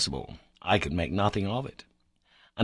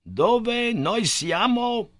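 put-on slow, much higher Italian-accented question; 13 semitones higher, 3 dB louder, much slower than usual. A narrator's reading. Professor trying Italian on the silent boy, slow and high. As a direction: ask slowly in Italian, reaching high. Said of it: "try him in Italian".

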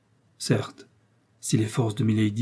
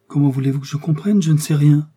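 higher, louder protest; bewildered protest; 4 semitones higher, 6 dB louder than usual. A narrator's reading. Novice protesting she knows nothing, bewildered. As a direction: protest with rising force.

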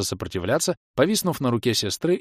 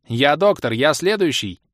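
loud, higher, insistent claim; assertive claim; 6 semitones higher, 6 dB louder than usual. A narrator's reading. Doc pushes in for wine, insisting. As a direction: stake your place in the queue. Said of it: "заявил док".

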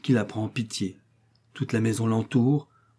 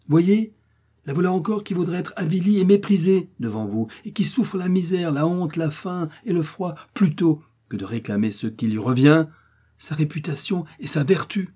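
impassioned defence; 7 semitones higher than usual. Acted. Old woman pleading Geneviève's case.

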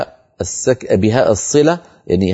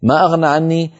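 ترتيل; Quranic recitation; 3 semitones higher, slower than usual. Shown as a demonstration. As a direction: recite the verse in a slow measured chant. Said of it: "الهاء ساكنة وصلا ووقفا".

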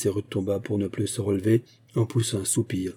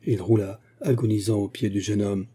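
usual speaking voice

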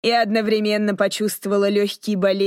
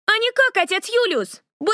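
put-on high cheeky little boy; very high teasing kid voice; cheeky child; 13 semitones higher than usual. A narrator's reading. Jam-covered boy teases.

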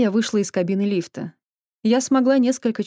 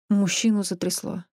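plain reading voice

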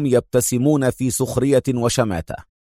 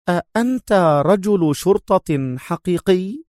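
higher, slow suspicious question; a touch of doubt; 7 semitones higher, slower than usual. Acted. The major doubting the inspector, slow and suspicious. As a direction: let doubt creep in slowly. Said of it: "والشك يعصف بها".

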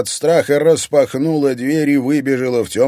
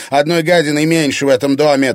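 raised, louder malicious follow-up; spiteful jab; 5 semitones higher, 5 dB louder than usual. A narrator's reading. Irina wishing to be rid of Anfisa, spiteful jab. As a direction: throw the insult out loudly. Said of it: "злобно бросила Ирина".

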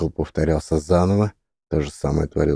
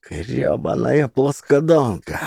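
growl through teeth; 7 semitones higher, slower than usual. A narrator's reading. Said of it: "рычит она сквозь зубы".

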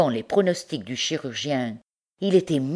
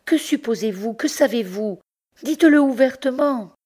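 high, urgent demand for the truth, rising louder at the end; anxious pleading; 10 semitones higher, 4 dB louder than usual. Acted. Bertrande begs Pierre to say it outright, high and urgent. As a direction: press him to speak openly.

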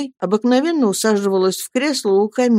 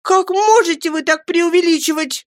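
high, louder tearful protest; tearful exclamation; 12 semitones higher, 3 dB louder than usual. A narrator's reading. Mother bursts out at Peggotty, high and tearful. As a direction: cry out, in tears. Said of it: "воскликнула матушка".

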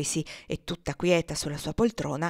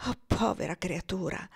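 involuntary exclamation; 4 dB quieter than usual. Acted. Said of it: "esclamò ... involontariamente".

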